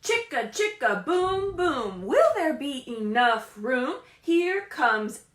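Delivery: loud, slow, sing-song storybook read; reading to children. Acted. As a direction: read it big for the little ones. Reads picture book aloud.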